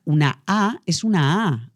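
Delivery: slow, wide-open "A"; clear articulation. Shown as a demonstration. Coach demonstrating the most open vowel, fully articulated "A". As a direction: stretch each "A" with an open mouth. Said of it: "hay que articular".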